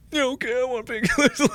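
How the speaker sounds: very high, pitiful little voice; mock sad whimper. Injured kid finally asking dad for painkillers, sadly. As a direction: imitate a tearful kid giving in. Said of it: "very sadly going like".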